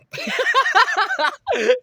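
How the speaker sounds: shrill cackle of the woman in the video; a high, mocking burst of laughter. Imitating the video woman, a high mocking laugh. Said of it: "the bitch's face on the bowl was like".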